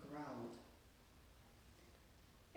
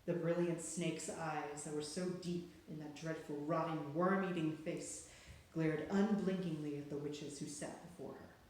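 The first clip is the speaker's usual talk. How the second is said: plain reading voice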